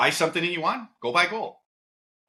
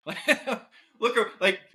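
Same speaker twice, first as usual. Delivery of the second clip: laughing; 9 semitones higher, much slower than usual. Real, not acted. Laughing mid mock retort.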